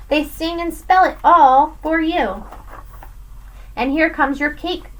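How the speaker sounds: plain reading voice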